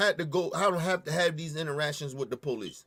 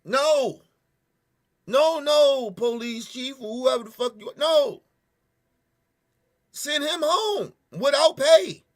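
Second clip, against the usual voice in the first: higher and louder, slow and insistent; loud angry demand; 6 semitones higher, 5 dB louder, slower than usual. Real, not acted. Telling the police chief to fire him, loud and slow. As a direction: insist hard, refusing any excuse.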